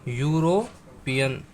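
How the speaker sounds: यूरोपियन said slowly, syllable by syllable; careful teacher's yu-ro-pi-an; slow careful pronunciation. Shown as a demonstration. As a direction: draw out यूरोपियन so the yu sound is clear.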